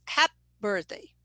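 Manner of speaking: HAPPY cut down to one syllable, "hap"; learner's hypercorrection. Shown as a demonstration. Teacher imitating a student's mistake, hypercorrected. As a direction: drop the final vowel of "Happy", saying "hap birthday". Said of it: "when a student says".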